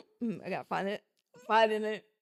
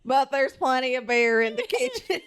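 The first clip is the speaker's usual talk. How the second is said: laughing through a mock country accent; country accent with giggles breaking in; amused country-accent reading. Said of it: "Now we're country".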